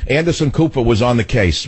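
ordinary delivery

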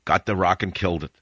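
ordinary talk

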